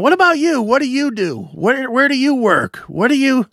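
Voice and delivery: mock comic firing questions at the crowd; prying opening comics. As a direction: imitate comics grilling the audience. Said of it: "they were literally going around".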